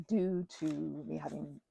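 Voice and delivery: ordinary delivery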